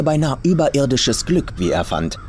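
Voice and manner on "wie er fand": ordinary delivery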